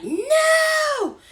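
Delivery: very high shouted no. Retold or imitated. Mother yells at Chloe taking the poison, very high shout. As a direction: yell it out in sudden panic. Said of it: "and the mama's like".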